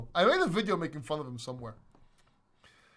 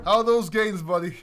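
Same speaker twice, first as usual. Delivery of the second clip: taunting; 2 semitones higher, 9 dB louder than usual. Real, not acted. Taunting a crypto skeptic.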